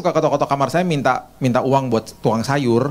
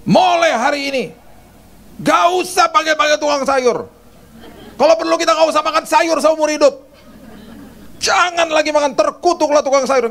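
much higher, louder hammed-up ranting; mock rampage; 13 semitones higher, 3 dB louder than usual. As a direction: pretend to blow up over the vegetable seller. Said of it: "Apakah saya ngamuk-ngamuk sama dia?".

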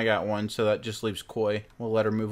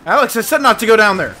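loud warning shout; 9 semitones higher, 14 dB louder than usual. Acted. Warning in game.

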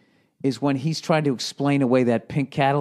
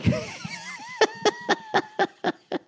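squeaky, breathless cackle; high wheezing laugh; 4 dB quieter than usual. Real, not acted. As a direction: crack up helplessly at your own impression. Breaks up at own impression.

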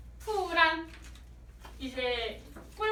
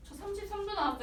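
reporting to manager; 6 semitones higher, 4 dB quieter, faster than usual. Retold or imitated. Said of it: "실장님께".

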